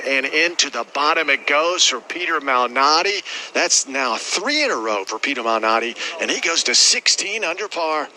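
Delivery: excited golf call. Acted. Putt drops, rising call.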